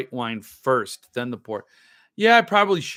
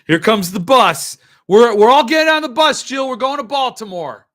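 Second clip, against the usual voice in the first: loud, high, thrilled announcement; excited calling out; 8 semitones higher, 11 dB louder than usual. Real, not acted. Telling Jill the bus is coming, loud and excited. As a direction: shout it like the party bus is here.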